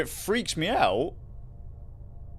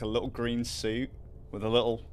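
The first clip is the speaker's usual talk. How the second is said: ordinary delivery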